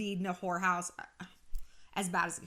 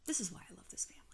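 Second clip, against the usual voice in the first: whisper; 8 dB quieter, much faster than usual. Real, not acted. Aside after quote.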